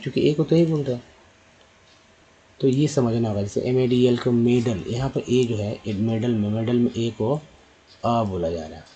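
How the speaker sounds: ordinary talk, nothing marked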